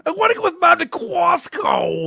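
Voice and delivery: put-on high, slow whining kid crying out; whiny kid voice. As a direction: beg like a whiny child. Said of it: "Opie's entire family talks like".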